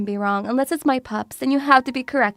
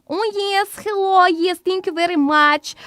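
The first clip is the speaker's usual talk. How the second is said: put-on higher, strong Eastern European accent; overly polite thick-accented thanks; 6 semitones higher than usual. Vlogger copying shop talk in English, thick accent. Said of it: "fat accents".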